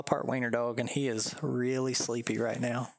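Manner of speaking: ordinary talk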